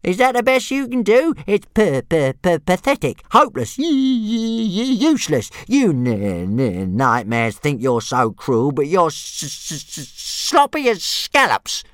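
stammering taunting dragon. Acted. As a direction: fling stammered insults gleefully.

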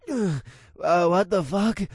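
slow, dazed, startled man; waking up confused; gasping man. A narrator's reading. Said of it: "the man gasped as he woke up".

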